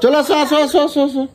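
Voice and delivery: coaxing call. Real, not acted. Coaxing the child to come.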